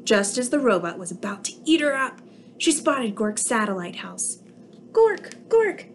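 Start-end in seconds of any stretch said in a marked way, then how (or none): 4.9-5.9 much higher, frantic alien cry; a tiny alien's panicked call for help. Acted. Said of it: "she cried".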